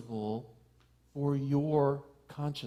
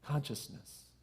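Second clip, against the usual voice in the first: much softer, almost breathed; hushed word; 11 dB quieter, slower than usual. Acted. Minister repeating the key word to the room, hushed. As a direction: let it fall quiet.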